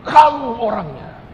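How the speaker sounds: loud, high, drawn-out accusing cry; imitating a man's sudden outburst; retold shout. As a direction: shout it out slowly, pointing the finger. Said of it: "dia teriak dan dia berkata".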